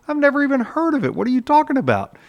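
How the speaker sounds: a clueless newcomer at the gate; baffled thief. Retold or imitated.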